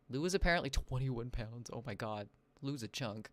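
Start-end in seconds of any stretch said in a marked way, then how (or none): none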